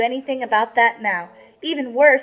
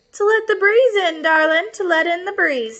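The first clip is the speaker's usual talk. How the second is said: wolf as grandma; 7 semitones higher than usual. Acted. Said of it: "Señor Lobo said".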